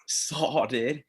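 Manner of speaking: a hint of a put-on bro. Guest warms up before arguing, lightly voiced bro. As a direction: test out a mock dude voice. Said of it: "my broy voice for a second".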